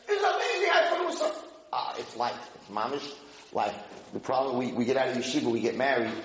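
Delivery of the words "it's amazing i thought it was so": high, eager voice of a study partner who finally gets it; thrilled exclaim. Retold or imitated. Learner in the study hall thrilled at finally understanding, high and eager. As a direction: burst out with delight, pitched way up.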